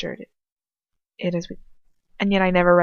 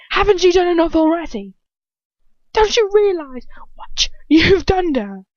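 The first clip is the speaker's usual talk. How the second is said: much higher, louder shout breaking into a strained hiss; furious shout; 11 semitones higher, 6 dB louder than usual. Acted. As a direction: scream it at him in fury. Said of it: "Ron shouts at him, his face red with fury".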